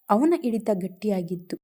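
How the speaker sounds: plain reading voice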